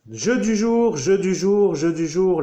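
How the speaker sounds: high, loud jingle-like call; loud announcement. Acted. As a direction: call it out like a show segment. Announcing the game of the day.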